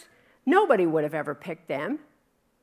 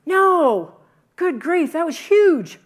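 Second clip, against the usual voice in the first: high, loud, emphatic no, good grief; excited exclamation; 10 semitones higher, 12 dB louder, slower than usual. Real, not acted. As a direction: burst out with delight.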